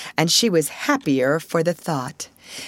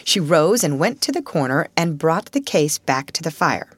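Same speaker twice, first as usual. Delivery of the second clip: usual speaking voice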